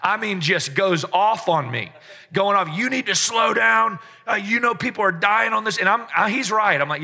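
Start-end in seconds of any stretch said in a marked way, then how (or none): 2.7-5.8 mock chewing out. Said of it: "This guy chews me out".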